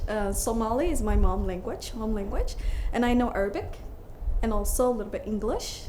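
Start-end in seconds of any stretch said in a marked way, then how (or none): none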